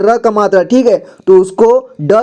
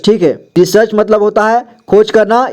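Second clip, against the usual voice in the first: usual speaking voice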